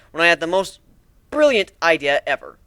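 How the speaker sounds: usual speaking voice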